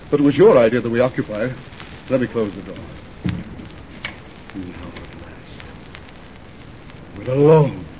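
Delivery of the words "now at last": very low, slow murmur; intimate hush. Acted. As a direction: breathe it out softly, finally alone. Groom alone with bride.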